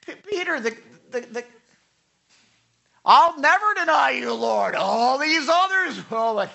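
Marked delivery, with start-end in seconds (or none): 3.0-6.5 higher, louder boastful promise; bold vow. Retold or imitated. Peter swearing loyalty to the Lord, loud and boastful. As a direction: puff up like a proud disciple.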